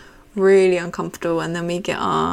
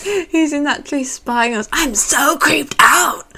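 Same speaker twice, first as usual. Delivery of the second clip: possessed; 8 semitones higher, 7 dB louder, slower than usual. Retold or imitated. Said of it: "Then she's like".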